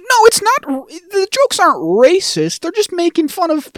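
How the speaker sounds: very high, strained mock protest; Dee. Co-host imitating Dee defending the jokes, high mock protest. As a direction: stretch it out high as a flustered defender. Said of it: "D is over here trying to be like".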